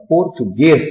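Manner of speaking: "português" with a strong final "guês"; stress on last syllable. Shown as a demonstration. Teacher showing an oxytone word, stressed "guês" in "português". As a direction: lean on the "guês" ending of "português". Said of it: "a sílaba tônica recaiu na última".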